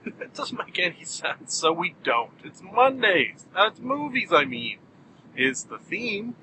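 ordinary delivery

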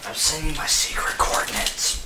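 hushed secretive whisper. Acted. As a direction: breathe it like a secret. Character sending secret message.

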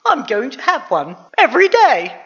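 high, loud declaration; exultant boy's big finish; triumphant. A narrator's reading. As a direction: finish on a gleeful shout. Jack triumphant at his new riches.

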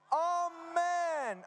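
high, long-held communal amen; shouted amen. Real, not acted. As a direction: call it out for the whole crowd to join, drawn out. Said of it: "Kaikki yhdessä ääneen huudetaan". (korkea, venytetty huudettu aamen)

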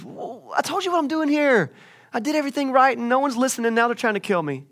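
high-pitched mock whine; hammed-up whiny protest; whining. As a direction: complain like an aggrieved prophet, pitch up. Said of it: "Now stop whining".

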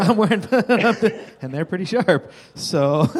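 giggling through the words; laughing. Real, not acted. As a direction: laugh while you talk about the flip-flops. Laughing at the arrow mishap.

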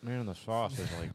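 mouth burning from spicy food. Real, not acted. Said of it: "my lips are on fire".

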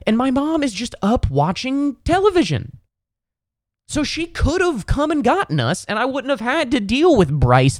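high, squeaky indignant whine; outraged disbelief. Real, not acted. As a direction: let it climb high in betrayed outrage. Outraged about mom being awake.

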